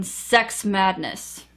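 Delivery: ordinary talk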